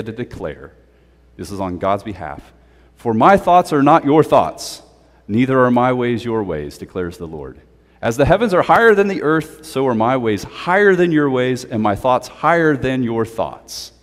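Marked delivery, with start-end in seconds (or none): 3.0-13.9 raised, declaiming voice for God; lofty scripture proclamation; proclaiming scripture. Acted. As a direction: announce it like a prophet. Proclaims God's words.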